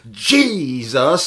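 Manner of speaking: loud, high mock healer shout; hammed-up cry of the holy name; theatrical faith healer. Mocking healers' powerless words, loud theatrical shout. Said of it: "when they go".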